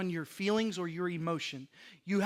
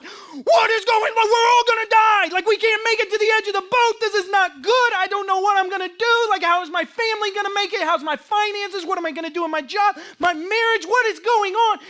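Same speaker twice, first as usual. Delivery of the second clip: much higher, loud frantic shouting, a hammed-up meltdown; mock panic; 12 semitones higher, 10 dB louder than usual. Believer in the storm crying out over every worry, frantic mock panic shout. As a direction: rattle off every worry as if the boat is sinking.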